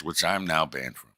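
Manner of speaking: ordinary delivery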